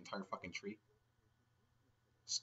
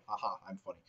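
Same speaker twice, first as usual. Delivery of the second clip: ordinary delivery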